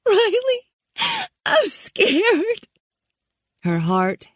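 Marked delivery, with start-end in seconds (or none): 0.1-2.7 very high tearful cry; a girl sobbing for help; weeping and scared. A narrator's reading. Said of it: "She started to weep".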